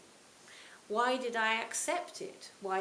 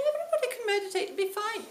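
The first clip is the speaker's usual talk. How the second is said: a quick, higher mock Scottish accent, brushing doubt aside; terrible Scottish accent; 13 semitones higher, 7 dB louder, faster than usual. Teacher waving away a newcomer's doubts, in a fast mock Scottish accent. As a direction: rattle it off in a hammed-up Scottish accent. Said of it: "it's a terrible Scottish accent".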